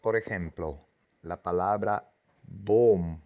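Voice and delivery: normal talking voice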